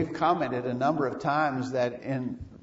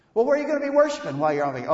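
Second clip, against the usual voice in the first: quick, higher-pitched question; rapid-fire query, pitched up; questioner's voice; 7 semitones higher, much faster than usual. Acted. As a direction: fire off the question as another person. Voices someone questioning the vacationers.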